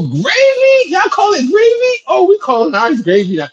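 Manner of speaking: high, incredulous mocking; mock disbelief. Mocking his co-host for calling pasta sauce gravy, incredulous. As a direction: squeal it in fake shock.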